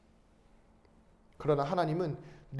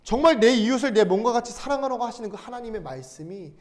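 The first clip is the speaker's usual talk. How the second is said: ordinary talk, nothing marked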